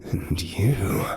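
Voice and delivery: slow, unvoiced evil whisper; sinister whisper. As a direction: hiss it at each of them. Villain's whispered speech.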